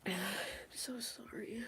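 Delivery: soft, high, tearful voice; crying. Real, not acted. Streamer consoling a bereaved parent, quietly crying. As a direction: barely get the words out through tears. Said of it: "Crying on YouTube is wild".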